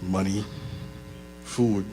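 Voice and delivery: quiet, low list of stray thoughts; distracted men's thoughts. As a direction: think aloud in short, separate bits. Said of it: "we just be like".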